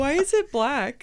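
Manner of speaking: high, slow worried question; puzzled and uneasy; alarmed. Real, not acted. Alarmed at stain on arm.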